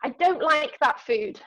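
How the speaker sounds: a slow, emphatic mock complaint; imitating brother. Sister imitating her brother blurting to her mid-run, mock complaint.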